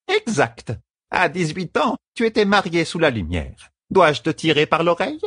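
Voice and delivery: high-pitched nagging elder's voice; stern scolding servant. A narrator's reading. Servant rebuking her grown mistress, stern.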